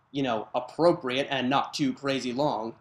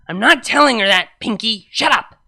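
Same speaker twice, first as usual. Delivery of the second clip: loud, higher irritated snap; angry snap; 5 semitones higher, 11 dB louder than usual. Acted. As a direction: bark at Pinky to shut up. Snapping at Pinky.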